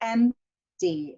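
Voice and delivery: "empty" with a long, loud first syllable, weak second; slow "empty", hit the first syllable; stressed-unstressed demo. Shown as a demonstration. Teacher demonstrating stressed-unstressed words, first-syllable stress on "empty". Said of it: "So this is stressed, unstressed".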